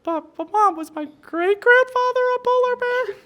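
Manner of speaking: very high, slow little cub voice; baby polar bear. Acted. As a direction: ask it like a curious tiny cub. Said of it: "looks at the mom again and goes".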